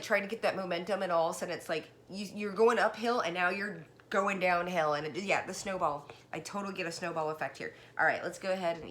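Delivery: ordinary talk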